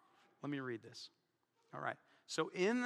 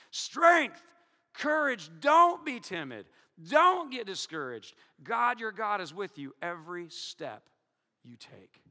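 preaching at full force; 5 dB louder, slower than usual. Acted. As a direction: thunder the command, then hush the comfort.

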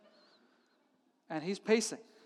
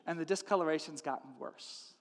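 ordinary talk, nothing marked

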